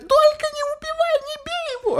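very high shrieking plea; panicked scream. Retold or imitated. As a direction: shriek in fear for him. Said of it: "она начинает орать".

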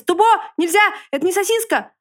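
высокий отчаянный крик; кричи на зверя, чтобы отпустил. A narrator's reading. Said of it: "кричал лис". (громко кричать на акулиску)

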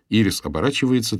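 plain reading voice

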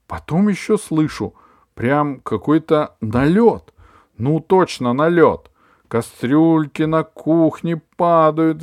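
high, loud breathless report; excited gossip. A narrator's reading. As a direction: tell it like a thrilling raid. Aunt Valya excited report.

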